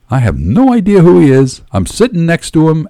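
high, emphatic disbelief; agitated. A narrator's reading. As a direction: protest it to yourself in alarm. Alarmed thoughts, shaken out of himself.

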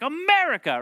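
high, loud mock-triumphant cheer; patriotic exclamation. Walking out of Top Gun, hammed-up patriotic cheer. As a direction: belt it out like a pumped-up moviegoer.

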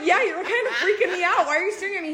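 high, loud retold protest with laughter; freaked-out confrontation. Diner retelling how she confronted the staring bartender, high and exasperated.

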